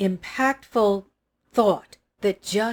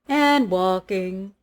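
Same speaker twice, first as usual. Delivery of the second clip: slow, stretched "walking"; "walking" said slowly and drawn out; slower than usual. Shown as a demonstration. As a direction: drag the word "walking" out to show the long walk. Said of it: "repeated walking, drawn out".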